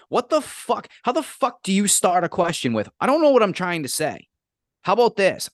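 heated rant about a dumb question; angry rant. Real, not acted. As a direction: vent at the clueless student. Ranting at the student.

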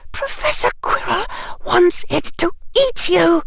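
put-on very high, panicked girl's voice; terrified shriek. A narrator's reading. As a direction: rise to a frightened shriek. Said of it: "Her voice rose to a shriek".

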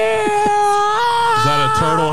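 high, slow, laughing question. Real, not acted. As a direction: crack up while asking. Laughing fit during guessing.

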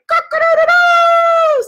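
high, loud, drawn-out crow; rooster crow. Retold or imitated. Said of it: "the rooster is like".

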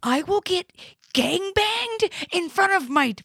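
high, strained shout at brother; retold screaming. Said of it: "I'm screaming at my own brother".

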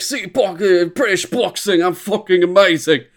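loud, high, swaggering brag; hammed-up arrogant boxer boast; cocky boxer. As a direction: show off like a cocky British bruiser. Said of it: "just to be like".

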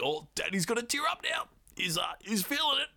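high, hammed-up welling-up voice; mock teary dad. Father moved by his kids' story, mock teary. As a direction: play the dad about to cry, overdone. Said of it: "He's like".

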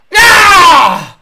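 high, loud drawn-out screaming celebration; over-excited yell. Retold or imitated. Host mimics racer cheering a Cobra run, wildly excited. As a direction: imitate a racer losing it with excitement. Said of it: "He's so excited".

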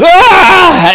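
high, loud drawn-out "aah"; acted cry of agony; pained scream. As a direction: scream as radiant energy hits. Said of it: "He goes".

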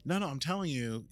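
usual speaking voice

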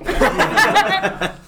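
ordinary talk, nothing marked

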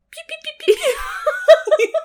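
high, loud laser pews; rapid-fire blaster noises; pew sound effects. Pretend. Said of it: "that's my job".

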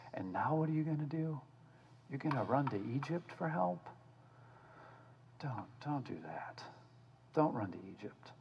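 soft, hushed begging; tender plea to stubborn children; pleading softly. Retold or imitated. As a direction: plead with them quietly. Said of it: "Isaiah's just begging him".

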